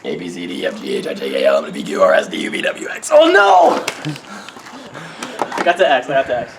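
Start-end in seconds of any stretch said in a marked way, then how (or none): 0.0-3.1 letters burped low and rough; burping the words. Shown as a demonstration. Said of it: "I can burp my A B Cs in one burp". 3.1-3.8 high loud Oh no; dismayed cry. Real, not acted. Failed the burp.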